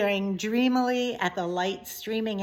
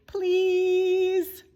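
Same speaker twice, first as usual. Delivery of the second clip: pleading princess; 11 semitones higher, 4 dB louder than usual. Acted. Princess begging father.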